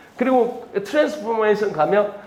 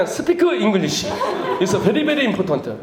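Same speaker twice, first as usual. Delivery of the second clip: Korean accent imitation; much slower than usual. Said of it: "한국 사람 만나면".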